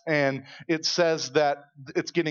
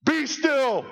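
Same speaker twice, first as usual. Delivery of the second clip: high, loud commanding call; Jesus rebuking the storm, commanding; 12 semitones higher, 6 dB louder than usual. Retold or imitated. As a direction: order the sea to calm. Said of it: "he's like".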